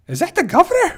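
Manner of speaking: high, disbelieving gasp of a question; shocked to spot someone, rising high. Retold or imitated. Fan seeing the governor in a photo, high shocked question. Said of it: "You're like".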